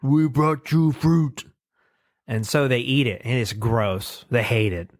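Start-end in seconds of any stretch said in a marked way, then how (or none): 0.0-1.4 slow, higher dopey caveman offering; proud Neanderthals. Retold or imitated. Neanderthals offering fruit, in a slow, higher dopey caveman voice. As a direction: say it like a simple caveman proud of a gift. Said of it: "They're like".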